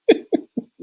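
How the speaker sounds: real burst of breathy laughter; amused laugh. Real, not acted. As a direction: laugh out loud, unable to help it. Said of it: "Sorry, I'm laughing".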